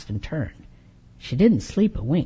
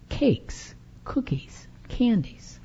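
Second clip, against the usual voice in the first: whispered list; 4 semitones higher, 8 dB quieter, slower than usual. Acted. Narrator listing Befana's baked gifts in a delighted whisper. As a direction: whisper each treat with delight.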